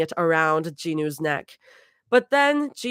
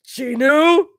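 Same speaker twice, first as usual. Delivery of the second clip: calling out; 8 semitones higher, 8 dB louder than usual. Acted. Calling out loudly.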